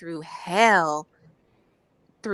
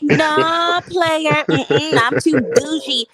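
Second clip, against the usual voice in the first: sassy brush-off; 8 semitones higher, 6 dB louder, slower than usual. Retold or imitated. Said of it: "I was like".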